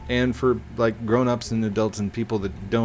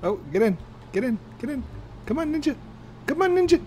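very high, squeaky little voice; squeaky urging; 13 semitones higher, faster than usual. Pretend. Gamer calling Ninja into the vehicle, high squeaky urging. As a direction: urge them along in a climbing falsetto.

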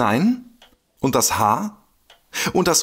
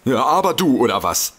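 ordinary delivery

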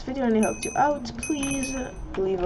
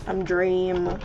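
ordinary talk, nothing marked

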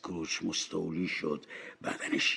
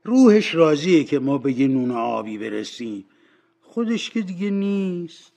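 high, louder cleaning woman excusing herself; self-excusing cleaning woman; 6 semitones higher, 9 dB louder than usual. Acted. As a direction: brush off the guilt in a raised voice.

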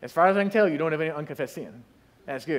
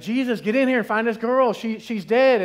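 urgent plea; 4 semitones higher, 6 dB louder than usual. Retold or imitated. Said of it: "they're like".

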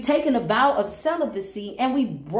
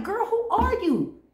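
incredulous; 11 semitones higher than usual. Retold or imitated. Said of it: "I'm like".

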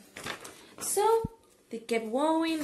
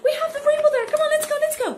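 high, loud and thrilled, almost crying with joy; excited shout; 8 dB louder, much faster than usual. Acted. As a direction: yell to everyone in delight. Elmer spots the rainbow.

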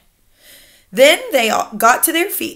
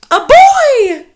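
high, loud shriek of glee; witches crying out; 11 dB louder than usual. A narrator's reading. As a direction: shriek it like a gleeful witch pack. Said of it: "cried the witches".

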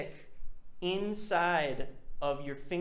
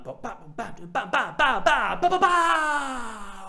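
falling "ba" zaps climbing to a loud, long cry; sci-fi sound effect; 7 semitones higher, 5 dB louder than usual. Shown as a demonstration. Teacher imitating a sci-fi movie, rising volley of swooping bas. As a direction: build repeated bas higher and louder, last one sliding down. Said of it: "like you're in a sci-fi movie".

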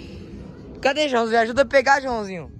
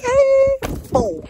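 sudden loud yelp; excited shout; 5 dB louder, much slower than usual. Real, not acted. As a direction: shout it out, startled. Shouting at the falling kite.

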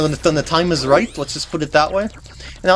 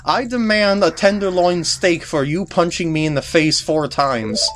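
ordinary talk

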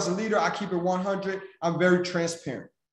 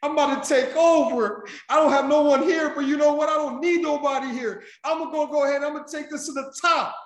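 much higher, louder boastful voice; imitating triumphant friend; 10 semitones higher, 4 dB louder than usual. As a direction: play her bragging after nailing it. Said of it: "she says".

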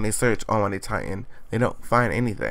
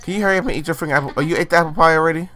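fast, higher hurry; impatient rush; 5 semitones higher, 4 dB louder, much faster than usual. Real, not acted. Vlogger nagging her Sim to finish eating, rushed. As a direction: rattle it off impatiently.

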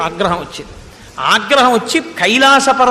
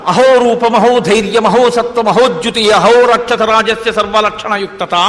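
verse recitation; 4 dB louder than usual. Acted.

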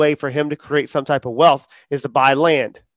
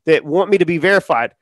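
ordinary talk

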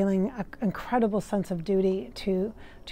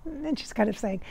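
usual speaking voice

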